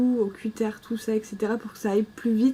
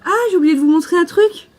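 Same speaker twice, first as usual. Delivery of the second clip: sudden realization; 6 semitones higher, 9 dB louder than usual. Real, not acted. Suddenly remembers something to show.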